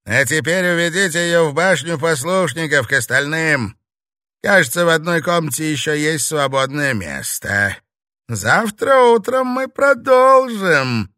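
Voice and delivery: loud, high, imperious orders; domineering villainess wrapping up; commanding witch mistress. Acted. Witch mistress dismisses the novice.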